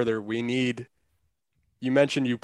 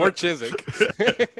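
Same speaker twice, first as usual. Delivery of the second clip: laughing; 8 semitones higher, much slower than usual. Real, not acted. Repeated joke, laughing.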